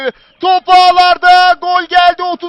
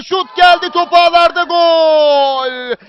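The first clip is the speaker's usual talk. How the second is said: screaming football commentator; 31 dB louder, much slower than usual. Real, not acted. Late goal call, live commentary.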